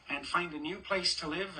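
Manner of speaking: plain reading voice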